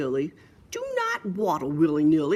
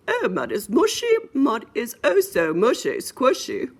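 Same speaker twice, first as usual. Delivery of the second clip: higher, louder mommy pig voice; 3 semitones higher, 6 dB louder than usual. Acted. Mommy pig delighting in the mud, higher mommy voice. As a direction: play the storybook mom, pitched up. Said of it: "Said the mommy".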